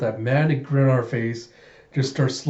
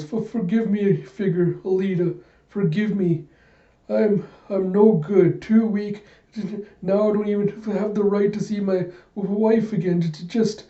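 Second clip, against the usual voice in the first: much higher, broken, stammering plea; tearful plea; 7 semitones higher than usual. Acted. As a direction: beg forgiveness through tears. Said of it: "Figure just starts crying".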